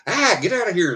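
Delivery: mock shooing. Shooing the puppy away.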